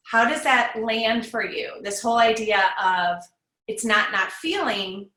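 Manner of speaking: ordinary delivery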